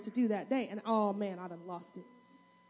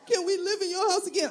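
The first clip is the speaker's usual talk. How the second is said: higher, mock child plea; children pleading; 7 semitones higher, 4 dB louder than usual. Children asking mother to live at home again, mock pleading. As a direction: imitate the kids asking to come home. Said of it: "Now ask me".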